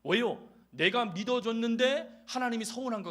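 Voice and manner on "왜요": usual speaking voice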